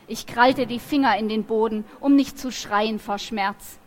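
ordinary delivery, nothing marked

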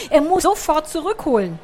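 ordinary delivery, nothing marked

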